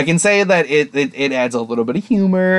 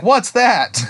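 high-pitched, oblivious question; mock bafflement; 9 semitones higher than usual. Slayer told it's an ogre, imitated cluelessness. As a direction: lift the pitch as if the word means nothing.

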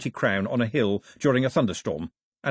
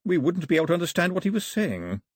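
smooth courtier's retort, higher pitch; smooth reply; 7 semitones higher than usual. A narrator's reading. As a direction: carry on smoothly, politely unruffled.

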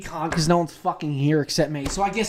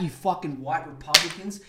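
ordinary talk, nothing marked

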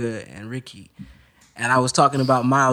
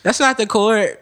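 wide-eyed, higher-pitched caricature; Ricky; 7 semitones higher, 3 dB louder, slower than usual. Acted. Friend mimicking a bandmate objecting during playing, wide-eyed caricature. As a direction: put on a bandmate's startled mannerisms. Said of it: "because Ricky has a mannerisms".

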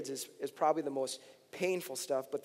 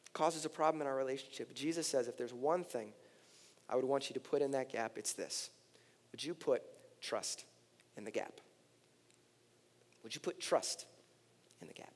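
hushed sermon appeal; 6 dB quieter than usual. Acted. Pastor voicing Jesus asking for trust, hushed appeal. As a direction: drop down and let each phrase land. Quiet appeal after forceful preaching.

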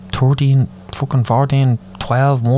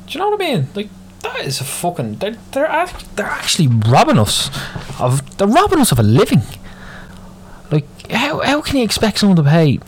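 exasperated rant; 11 semitones higher than usual. Real, not acted. Ranting to viewers about toll robbery, high-pitched exasperation. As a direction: voice jumps much higher in outrage.

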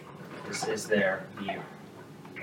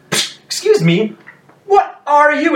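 high, loud, slow startled ask; shocked patron gawking at the creature; startled question; 10 dB louder, slower than usual. Acted. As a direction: recoil at the sight. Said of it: "he goes".

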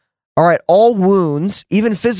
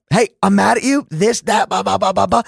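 retold scream; 4 semitones higher than usual. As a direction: imitate an angry outburst without real volume. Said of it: "I pretty much screamed".

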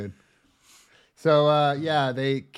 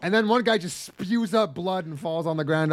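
ordinary talk, nothing marked

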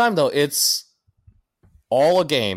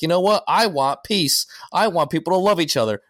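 mock peace plea; 6 semitones higher than usual. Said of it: "and I'm like".

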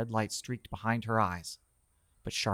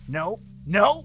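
loud protest; 12 semitones higher, 9 dB louder than usual. Acted. Man struggling.

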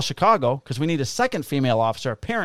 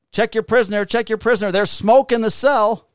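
higher, louder urgent call; urgent dispatch call; 8 semitones higher, 5 dB louder than usual. Retold or imitated. Dispatch warning the cops about smoke. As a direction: sound the alarm over the radio. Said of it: "they're like".

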